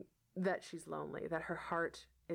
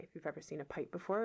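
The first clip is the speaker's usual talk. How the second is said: ordinary delivery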